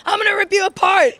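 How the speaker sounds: loud, high playful threat; mock threat. Wrestler voicing her fallback line, loud mock threat. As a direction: ham up a big threat.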